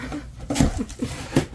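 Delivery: breathy little laugh; soft laugh. Real, not acted. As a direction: laugh it off, embarrassed. Laughs at her messy room.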